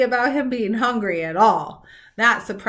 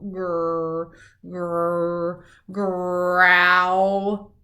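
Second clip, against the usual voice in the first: low growling rising louder; 3 semitones lower, 3 dB louder, much slower than usual. A narrator's reading. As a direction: rumble it like a beast. Scary growl sound.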